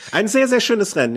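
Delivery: high mocking echo of his words; sarcastic parroting. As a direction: repeat it back with sarcasm. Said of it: "jetzt sag jetzt nicht verkackt".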